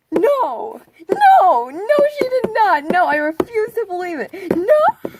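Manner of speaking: very high, very loud repeated no; screaming denial. Retold or imitated. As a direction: scream it out in refusal. Hearing Amy found note.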